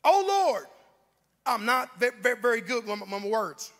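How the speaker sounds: loud plea that breaks into stutters; stammering Moses. Acted. Preacher acts Moses making excuses to God, stammering. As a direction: stumble and repeat, struggling to get words out. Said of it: "until he said these words".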